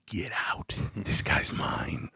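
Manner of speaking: low whispered command; quiet, possessive whisper; hushed order. Retold or imitated. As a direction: whisper it as a firm dismissal. Ordering detectives out of room.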